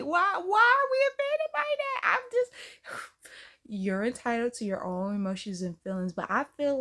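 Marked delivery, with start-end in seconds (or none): 0.0-3.1 exasperated outcry. Real, not acted. Exasperated with viewers.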